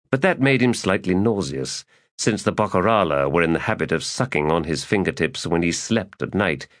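ordinary delivery

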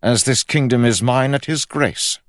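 high, loud royal proclamation; king's recitation. A narrator's reading. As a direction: declaim it like a rehearsed royal creed. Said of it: "It sounded like a recitation".

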